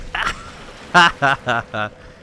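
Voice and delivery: loud burst of high laughter; high, loud cackling laugh; real laugh. Real, not acted. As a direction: break off into helpless laughing. Laughs at the game mid-sentence.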